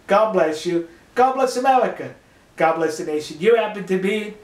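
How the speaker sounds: usual speaking voice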